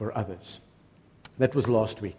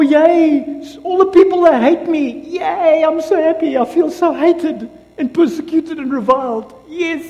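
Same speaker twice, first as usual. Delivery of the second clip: sarcastic glee; 12 semitones higher, 7 dB louder than usual. Pretend.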